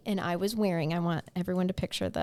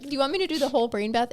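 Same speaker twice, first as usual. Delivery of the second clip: ordinary talk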